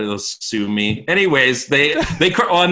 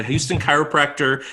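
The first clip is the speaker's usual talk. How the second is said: whiny ad voice; 5 semitones higher than usual. Retold or imitated. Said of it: "he's, like, really whiny voice".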